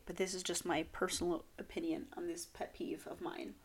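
soft hedging whisper. Real, not acted. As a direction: murmur the disclaimer under your breath. Quiet disclaimer.

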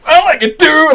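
re-enacted jump-scare reaction, high and loud; startled yell. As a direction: yelp it like you've been jump-scared. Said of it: "I always forget how loud I have that set".

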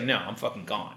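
low, quiet acted brush-off; dismissive refusal. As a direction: drop it low and soft, done with him. Acting her final cold refusal.

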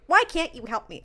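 put-on loud, high childish whine; whiny tantrum. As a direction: stomp and whine. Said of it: "stomp my feet ... be like".

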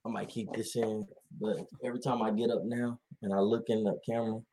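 ordinary talk

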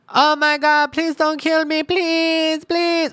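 shrieking, high-pitched begging for life; hammed-up high, loud pleading; panicked screaming. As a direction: imitate a coworker screaming in terror, loud. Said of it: "Jesse's screaming".

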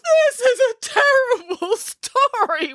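high, drawn-out theatrical wail. Acted. Listener upset by Nanny's story ending, wailing. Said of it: "wailed Boris".

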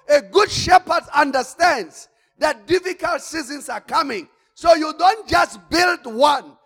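loud, high, driving sermon shout; preaching at full force. Acted. Preacher to congregation, loud full-force preaching. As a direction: thunder it at the congregation.

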